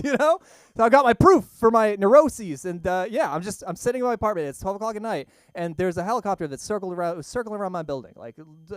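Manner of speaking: ordinary talk, nothing marked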